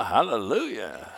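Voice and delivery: ordinary talk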